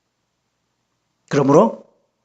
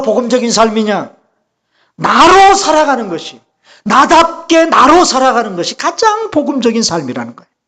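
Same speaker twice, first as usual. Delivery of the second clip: raised, insistent sermon climax; emphatic preaching; 6 semitones higher, 3 dB louder than usual. Real, not acted. Preacher defining the gospel life, emphatic raised preaching. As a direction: drive the point home with swelling stresses.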